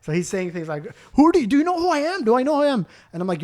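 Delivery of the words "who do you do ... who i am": outraged customer. Retold or imitated. Customer quoted.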